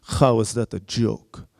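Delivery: stern guard in a mock Russian accent; Russian-sounding accent. Guard rejects comedian's excuse about his joke, imitated Russian accent. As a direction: challenge him in a put-on Russian-like accent. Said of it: "my accent is gonna sound Russian".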